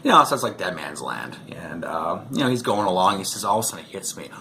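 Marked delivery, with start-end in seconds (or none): none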